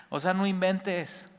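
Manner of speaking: high, slow incredulous no inventes; mock disbelief. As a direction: act out being floored by the teaching. Listener's stunned reaction.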